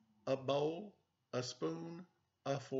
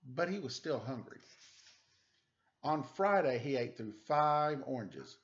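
put-on reading to children; 3 semitones higher, 6 dB louder than usual. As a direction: read it like a bedtime story for little kids. Reads picture book aloud to children.

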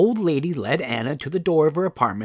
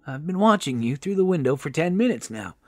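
lightly voiced helpful old lady; higher-pitched, chatty rescuer; kindly old woman; 6 semitones higher, 4 dB louder than usual. A narrator's reading.

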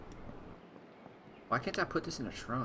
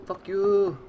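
high, loud playful jeer; mock jeer; 8 semitones higher, 8 dB louder than usual. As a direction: toss it off as a joke. Mock insult to teammate.

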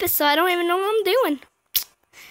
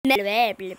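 blahs dropped low in pitch; low nonsense babble; 7 semitones lower than usual. Pretend. Playing with nonsense syllables.

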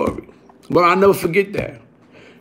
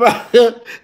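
short burst of laughter; 3 semitones higher than usual. Real, not acted. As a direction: laugh again briefly. Still laughing at own story.

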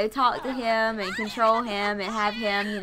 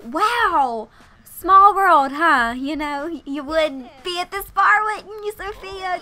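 higher, louder mocking sister voice; snide taunt; 6 semitones higher, 8 dB louder, slower than usual. Clara loudly taunting Sophia at the bar. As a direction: sneer it at her rival. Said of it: "just gonna be like".

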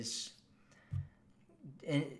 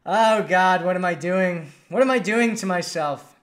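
loud, higher laughing groan at himself; embarrassed outburst; 4 semitones higher, 11 dB louder than usual. Real, not acted. Host caught blurting an inside joke, loud embarrassed exclaim. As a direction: cringe out loud at your own slip.

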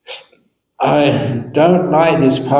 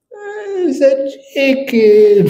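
high, slow, each word pressed; stubborn glare; 11 semitones higher, much slower than usual. Acted. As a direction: stare him down with it. Wife glaring in her eyes.

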